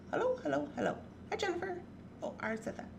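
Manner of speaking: ordinary talk, nothing marked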